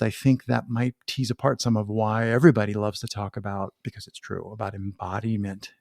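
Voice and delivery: ordinary talk, nothing marked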